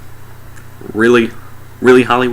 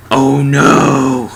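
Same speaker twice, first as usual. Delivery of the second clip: mock dismay; 4 semitones higher, 5 dB louder than usual. Mock dismay at fallen curtain.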